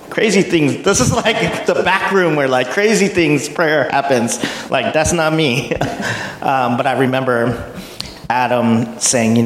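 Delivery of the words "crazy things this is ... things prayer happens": high-pitched, laughing through the words; amused disbelief. Retold or imitated. Newcomer recalling the mysterious back room, amused disbelief. Amused retelling of own bewilderment.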